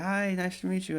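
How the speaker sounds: high-pitched greeting voice; singer imitation. Ren looking at him, imitated high greeting.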